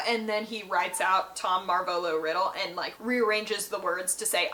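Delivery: ordinary talk, nothing marked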